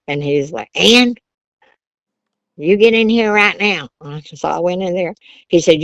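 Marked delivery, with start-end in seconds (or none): 0.7-3.9 angry father. Retold or imitated. Angry father calls daughter in.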